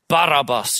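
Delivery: loud cry with falling pitch; crowd's cry. A narrator's reading. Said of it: "schrien sie".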